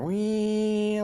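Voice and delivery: long high wee of a revving engine; high engine whine. Retold or imitated. Kid revving out of the mud, high held wee. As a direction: imitate the bike screaming at high revs. Revving through muddy corner.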